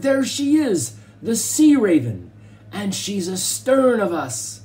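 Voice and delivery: high, loud roar, slowing at the end; roaring captain. A narrator's reading. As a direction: bellow it across the deck. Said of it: "he roared".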